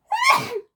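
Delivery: sudden high squeaky sneeze. Real, not acted. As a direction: let out a real, squeaky sneeze. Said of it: "that came out really squeaky".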